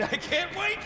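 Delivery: high, rising eager burst; excited. Acted. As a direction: let the excitement spill out. Repeats phrase, building excitement.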